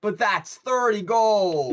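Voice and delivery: excited protest. Acted. Performer argues against keeping to the play, excited. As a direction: wail it at your companions, dazzled by money. Player pleads for taking the money.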